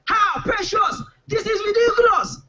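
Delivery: high, shocked scream with a laugh in it; outraged comic shriek. Retold or imitated. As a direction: catch her cheating and shriek. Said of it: "I screamed".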